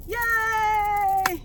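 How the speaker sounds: a high, bright "Yay!" squeal; excited cheer. Real, not acted. Coach cheering her viewers after the affirmations, high excited yay. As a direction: celebrate like you just finished something good.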